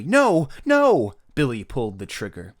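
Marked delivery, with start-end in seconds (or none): 0.0-1.1 loud, high, drawn-out pleas; frantic yell. Acted. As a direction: yell in a mother's panic. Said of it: "yelled his mother frantically".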